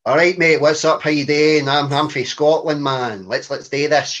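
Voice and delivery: loud, hammed-up Glaswegian greeting; exaggerated Glaswegian patter, opening high and loud; Glaswegian accent. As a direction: lay on the Glaswegian thick, like greeting a mate. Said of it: "default into a more Glaswegian sound".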